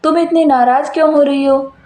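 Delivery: low voice, puzzled at her anger; husband's voice. Acted.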